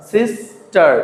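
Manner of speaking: "Sister" in two beats, sis, tar; split into syllables. Shown as a demonstration. Teacher giving a two-syllable example, "sister" split sis, tar. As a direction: pause inside "sister" between sis and tar. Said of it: "দুটি অংশ থাকে".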